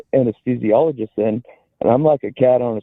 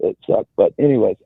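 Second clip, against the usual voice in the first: normal talking voice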